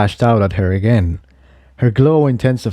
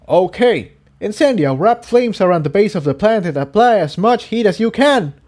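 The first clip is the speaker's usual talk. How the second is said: character voice; 10 semitones higher than usual. Acted. Jigsaw instructs during fight.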